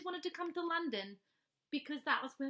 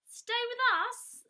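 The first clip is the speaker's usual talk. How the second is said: higher, slow, louder anxious echo; nervously; 10 semitones higher, 5 dB louder, slower than usual. Acted. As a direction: balk at the idea, rattled. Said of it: "said Mister Brown nervously".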